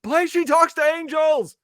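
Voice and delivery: crowd shouting a request. Retold or imitated. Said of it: "They're like".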